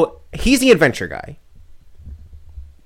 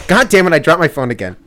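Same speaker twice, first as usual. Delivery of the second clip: loud, raised-pitch cursing; exasperated outburst; 4 semitones higher, 8 dB louder than usual. Real, not acted. Host cursing after dropping the phone, exasperated outburst. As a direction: curse out loud in sudden frustration. Drops phone while recording.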